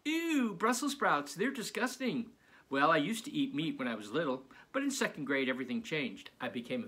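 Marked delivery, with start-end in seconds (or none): 0.0-2.3 put-on high, disgusted kid voice; a high mock yuck. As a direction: squeal it like a grossed-out kid. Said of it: "I know what you're thinking".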